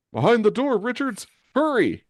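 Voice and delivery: very high, urgent call back; high-pitched hurried yell; urgent shout. Acted. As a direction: shout directions across a fight. Officer calls back urgently.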